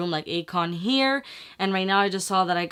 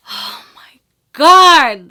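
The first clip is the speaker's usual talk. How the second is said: slow, breath-filled loud exclamation; huge relief; 7 semitones higher, 14 dB louder, much slower than usual. Real, not acted. Vlogger relieved by negative result, long loud exclamation. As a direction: draw it out, bursting with relief.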